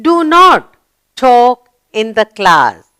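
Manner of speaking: slow, firm classroom rule; slow model reading. Shown as a demonstration. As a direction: read it out slowly as a rule. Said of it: "The sentence is complete".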